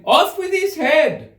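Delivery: high, slow, loud shouted order; furious shout. A narrator's reading. Furious queen at croquet, loud shout. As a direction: scream the sentence in a rage.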